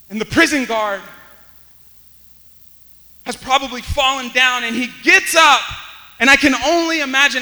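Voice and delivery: loud, high, driving storytelling; full-force preaching. Real, not acted. Preacher telling of the panicked prison guard, full-force preaching.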